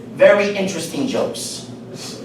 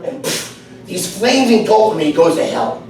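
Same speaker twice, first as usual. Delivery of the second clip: ordinary talk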